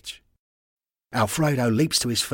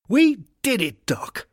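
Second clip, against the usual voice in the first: excited; 4 dB louder, slower than usual. Acted. Rovers have just won.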